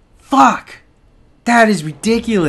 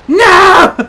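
high, loud yelp of panic; panicked shout; 12 semitones higher, 9 dB louder than usual. Real, not acted. Gamer cornered by the monster, high loud panic. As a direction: scream it as he closes in. Enemy attacks again.

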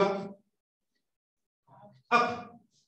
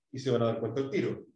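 normal talking voice

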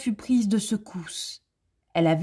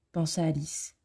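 usual speaking voice

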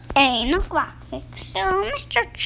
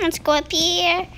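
ordinary talk

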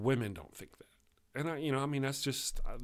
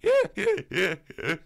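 loud high imitated laugh; high, punchy mock laugh in separate bursts; Andy's laugh; 7 semitones higher, 10 dB louder than usual. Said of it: "He laughs like that".

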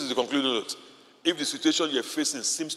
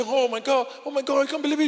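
mock tourist excitement; 10 semitones higher than usual. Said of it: "You say".

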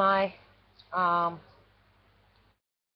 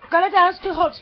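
louder, higher order to a pet; insistent command; 3 semitones higher, 8 dB louder, faster than usual. Real, not acted. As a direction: repeat the order firmly and loudly. Repeats the command more insistently.

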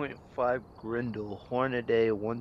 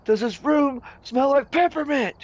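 high, loud, slow mock outrage of the instructor; hammed-up instructor sniffing at the room; 9 dB louder than usual. Student imitating the instructor annoyed at the class's peppermint drinks, high and loud.

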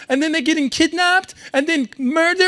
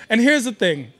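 ordinary delivery

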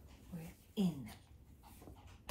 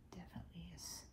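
very soft unvoiced whisper; 7 semitones lower, 14 dB quieter, faster than usual. Real, not acted. Owner whispering while filming her dog. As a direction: drop to a whisper.

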